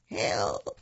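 understated low cry for help; a low, drawn call for help. A narrator's reading. An unseen voice in the snow, understated call for help.

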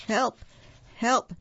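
loud, high elephant's cry; trumpeting. A narrator's reading. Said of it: "trumpeted Elephant".

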